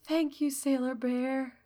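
a hint of a faint, fading voice; lightly voiced weak friend. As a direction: thank your rescuer with little strength left. Weak fallen friend thanks rescuer.

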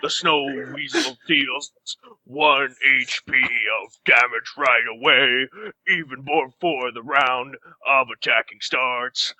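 slow, deliberate stat reading; game master card read. Acted. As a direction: read the monster card like a rulebook. Reads monster card slowly.

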